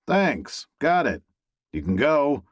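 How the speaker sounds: high, curt brush-off; snatch it back and dismiss him; curt dismissal. A narrator's reading. Yanking bowl, dismissing ex.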